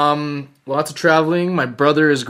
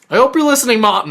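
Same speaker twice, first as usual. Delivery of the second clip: calling out; 10 semitones higher, 7 dB louder, faster than usual. Pretend. Teasing call-out to mom.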